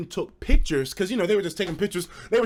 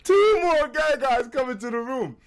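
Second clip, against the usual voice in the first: laughing; 7 semitones higher, 3 dB louder than usual. Real, not acted. Punchline retold, laughing.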